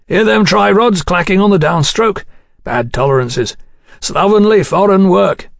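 Godwin, critical engineer. Acted. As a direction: find fault over the racket.

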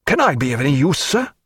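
a jovial captain gushing over the battle; Captain Muffet, triumphant. Acted.

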